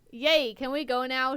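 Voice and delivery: high, loud, excited little squeal. A narrator's reading. Little girl asking to leave, squealing. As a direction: cheer in a child's eager squeal. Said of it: "she squealed".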